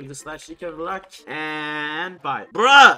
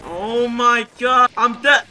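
dismayed; 4 semitones higher, 6 dB louder, slower than usual. Real, not acted. Gets killed in the game.

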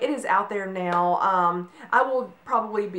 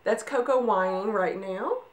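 usual speaking voice

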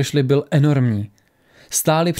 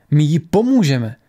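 firm, slightly raised insistence; 4 dB louder than usual. A narrator's reading. Tora insists they will help the woman, firmly. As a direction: state it with resolve. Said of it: "ptala se Tora pevně".

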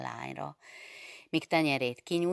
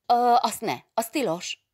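higher, much louder alarmed cry of no; snatch it back, crying out in childish fright; frightened cry; 10 semitones higher, 11 dB louder than usual. A narrator's reading. Young woman stopping her teacher from reading her note, crying out. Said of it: "kiáltott a lány".

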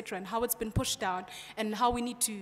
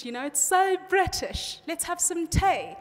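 put-on a playful, lightly posh British accent, higher and slowed; lightly hammed British accent, drawn out; mock posh British; 5 semitones higher, 4 dB louder, slower than usual. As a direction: send up your own British accent over tea. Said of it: "you can hear my accent is so British".

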